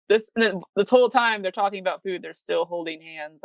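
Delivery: ordinary talk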